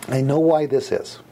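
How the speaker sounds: usual speaking voice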